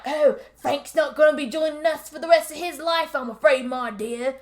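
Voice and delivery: hammed-up British menace; bad Cockney villain. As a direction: play a villain in terrible Cockney. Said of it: "super bad Cockney".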